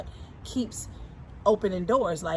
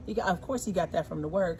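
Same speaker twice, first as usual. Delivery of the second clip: normal talking voice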